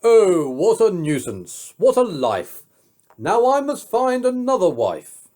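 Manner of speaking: high, loud wailing king; the king cries out in mock despair; king's lament. A narrator's reading. King to his court, loud mock wail. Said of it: "up and cried".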